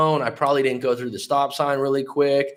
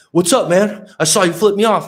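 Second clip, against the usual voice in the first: higher, louder, an angry driver's challenge; angry confrontation; 7 semitones higher, 5 dB louder than usual. Retold or imitated. Heavyset minivan driver confronting the narrator, an angry raised challenge. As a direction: square up to him, pitch raised and loud.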